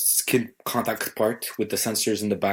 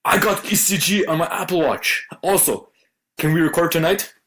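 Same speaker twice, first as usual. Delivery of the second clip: higher-pitched, eager announcement; excited text read-out; 7 semitones higher than usual. Retold or imitated. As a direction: imitate a friend thrilled with a new feature. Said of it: "then you go like".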